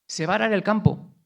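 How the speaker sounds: fervent preacher building the story; preaching at full force. Acted. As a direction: preach it out with fire. Passionate retelling of Saul.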